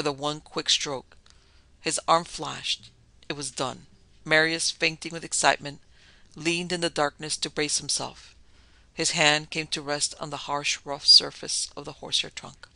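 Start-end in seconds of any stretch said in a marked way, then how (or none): none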